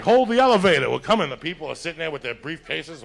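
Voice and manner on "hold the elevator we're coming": mom calling out. Retold or imitated. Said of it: "my mom's like".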